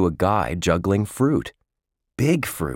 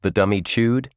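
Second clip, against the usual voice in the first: ordinary delivery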